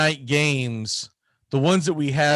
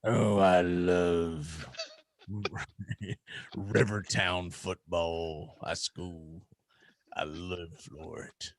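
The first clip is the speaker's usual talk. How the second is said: put-on slow, low, creepy old man drawl; 9 semitones lower, 8 dB quieter, slower than usual. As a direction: linger on every word like a lonely old fan. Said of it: "you got that creepy old man voice going".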